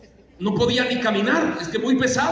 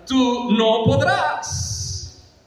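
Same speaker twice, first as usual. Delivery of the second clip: drawn-out, higher mock-royal dismissal; dismissive king; 4 semitones higher, much slower than usual. King Saul tells David he is not able, dismissive. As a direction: stretch it out, waving the challenger away.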